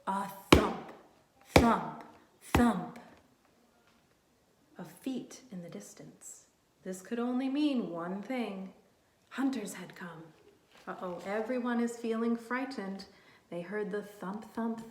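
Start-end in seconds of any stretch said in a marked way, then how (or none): 0.1-3.0 loud, heavy thump thump thump. Acted. Storyteller thumping footsteps. 4.8-6.4 whispered of feet in the distance; suspense whisper. Acted. Reading suspense. 9.3-10.2 whispered of feet in the distance; suspense whisper. Acted. Reading suspense.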